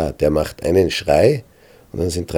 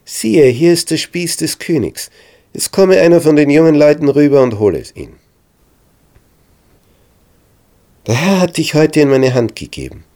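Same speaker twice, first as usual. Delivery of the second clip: higher, louder proclamation; calling out; 8 semitones higher, 7 dB louder than usual. A narrator's reading. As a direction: carry it across the valley. David calls back to Saul.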